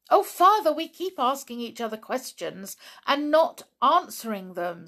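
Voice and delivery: high, loud protest from a daughter; exasperated girl. Acted. Daughter fed up with unanswered questions, exasperated.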